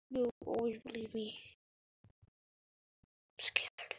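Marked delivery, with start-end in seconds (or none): 3.4-3.9 whispered, voice dropped right down; scared whisper. Acted. Player to friend in the dark, scared whisper. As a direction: breathe it out like something might hear you.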